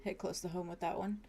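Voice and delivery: quiet. Acted. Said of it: "He says".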